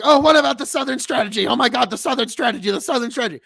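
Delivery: high, loud whiny mockery; mocking lefties. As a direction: parrot them like a broken record. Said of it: "and they're like".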